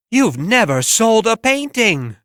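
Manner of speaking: very high incredulous gasp of a question; can't believe he never sold one; astonished critic. Acted.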